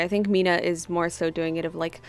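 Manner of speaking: usual speaking voice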